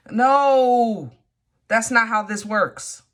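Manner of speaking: loud indignant refusal. Real, not acted. Reader rejecting a proposal by text, loud and indignant. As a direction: shut it down, outraged. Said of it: "I'm about to get mad".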